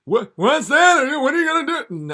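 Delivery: high, loud nervous comic voice; hammed-up high Seinfeld voice; squeaky Seinfeld. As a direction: yelp it like a startled Seinfeld. Seinfeld answering the devil.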